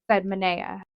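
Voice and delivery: ordinary delivery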